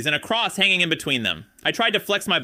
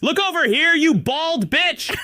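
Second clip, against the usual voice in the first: shouted insult; 12 semitones higher, slower than usual. Retold or imitated. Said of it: "His girlfriend shouted at me".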